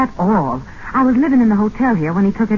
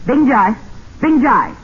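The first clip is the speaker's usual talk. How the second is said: calling out loud; 5 semitones higher, 3 dB louder than usual. Acted. Calling the guard.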